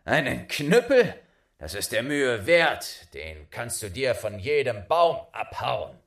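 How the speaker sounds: loud, mocking disbelief; scoffing father. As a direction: scoff at the son's prize. Scoffing father voiced in story.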